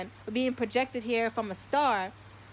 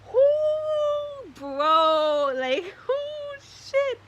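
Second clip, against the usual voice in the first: much higher, louder whooping; ecstatic whoo; 8 dB louder, much slower than usual. Real, not acted. Streamer overcome by the moment whoops to his viewers. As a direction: let out breathless whoops of awe. Overwhelmed by emotion live.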